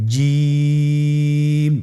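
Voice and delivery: জিম held for three alif, the vowel stretched; drawn-out Jim. Shown as a demonstration. Teacher showing the class how to pronounce জিম. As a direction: demo জিম slowly and clearly. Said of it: "আপনারা আমার সাথে বলুন".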